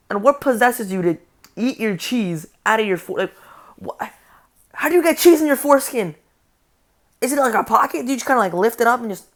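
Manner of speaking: put-on loud, disbelieving tirade at the kids; yelling at kids in outraged disbelief; incredulous yelling rant. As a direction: shout it like you cannot believe your ears. Ranting at kids in pool.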